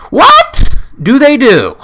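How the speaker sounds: high, loud and baffled; shouted in high disbelief; bewildered shout. Acted. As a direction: yell it like it makes no sense. Mock bewildered rant.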